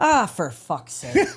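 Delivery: slow, drawn-out sigh of a curse; mock exasperation. Shown as a demonstration. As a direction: drag it out, playfully fed up. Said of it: "how about you go like this?".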